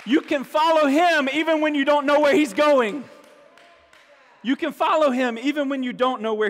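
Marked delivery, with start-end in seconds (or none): 0.0-3.0 loud, high and ringing; ringing proclamation. Acted. Preacher urging the church to trust, loud and high. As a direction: proclaim it boldly. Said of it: "repeated softer".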